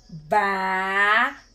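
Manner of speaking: "batata" stretched as ba... ta... ta; syllable by syllable. Shown as a demonstration. Teacher helping pupils write a word, syllable by syllable. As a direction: draw out each syllable of "batata". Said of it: "são as sílabas que nós".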